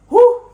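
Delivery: high, loud whoo. Real, not acted. Vlogger overwhelmed by the memory, loud whoo. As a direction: let out a big whoo. Emotional exclamation in vlog.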